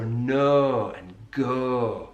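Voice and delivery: very slow "nur" and "gur" for no and go; elongated Yorkshire "no", "go"; Yorkshire fronted O. Shown as a demonstration. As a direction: hold a long vowel at the front of the mouth in "no" and "go". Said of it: "articulated at the front of the mouth".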